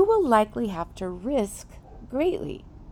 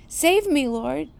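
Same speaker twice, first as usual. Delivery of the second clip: raised, louder cry for rescue; narrated shout; 5 semitones higher, 4 dB louder than usual. A narrator's reading. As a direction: call out for help, in a storyteller's mild shout. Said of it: "he shouted".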